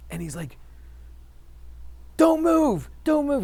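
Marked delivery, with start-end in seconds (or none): 2.2-3.4 high, loud shouted warning from the instructor; retold yell. Army Ranger instructor yells up at the student hanging upside down, high and loud. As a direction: bark the order up at the hanging climber. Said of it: "He starts yelling".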